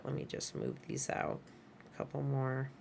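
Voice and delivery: very soft murmur while working; muttering to self. Real, not acted. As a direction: mumble it to yourself as you fiddle. Talking to self while adjusting keyframes.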